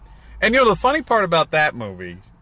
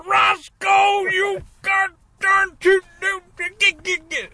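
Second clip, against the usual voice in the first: Boss Hogg yelling; 14 semitones higher, much slower than usual. Retold or imitated. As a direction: yell at Roscoe like a furious sheriff's boss. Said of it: "he's on Dukes of Hazzard".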